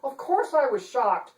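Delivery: high, prim and indignant; a dainty lady playing at outrage; fussy chancellor. Chancellor protesting to the detective, high and prim. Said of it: "Chancellor Phyllis Funderburk was saying".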